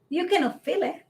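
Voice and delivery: ordinary delivery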